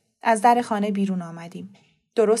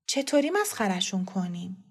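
put-on softer, uncertain child's question; hesitant; 4 semitones lower, 8 dB quieter than usual. A narrator's reading. Boy hesitantly asks grandma how to fool them. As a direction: ask it doubtfully, holding back. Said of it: "با تردید گفتم".

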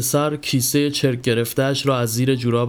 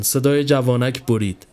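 ordinary delivery

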